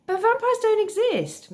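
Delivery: high-pitched, loud disbelief; incredulous protest. Acted.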